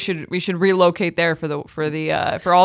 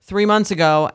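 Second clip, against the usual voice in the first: usual speaking voice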